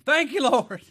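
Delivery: high, loud shout of thanks to God; thankful cry. Acted. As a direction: cry it out with a laugh behind it. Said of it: "We either cry thanking him".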